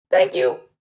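high little thank-you squeak; squeaky mouse. A narrator's reading. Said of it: "the mouse squeaked".

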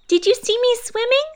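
very high excited squeak. A narrator's reading. Roo asking everyone after climbing out, excited squeak. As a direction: squeak it, bursting with pride. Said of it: "squeaked Roo excitedly".

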